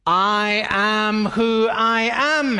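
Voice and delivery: slow, firm, weighty I am who I am; firm self-assertion. Retold or imitated. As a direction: plant every word as Heather. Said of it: "Heather would say".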